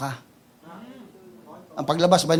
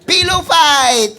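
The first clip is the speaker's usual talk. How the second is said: high, slow hammed-up battle cry; mock battle cry; 8 semitones higher, 5 dB louder, much slower than usual. Preacher mocks going to war with a pillow, slow hammed-up cry. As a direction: shout it like a joke war cry. Mocking soldiers bringing pillows.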